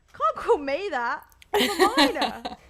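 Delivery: loud, high, put-on outrage; mock indignation. Pupil retorts to the teacher's insult, mock indignant. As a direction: protest like a wronged pupil, half laughing. Retold cheeky comeback to teacher.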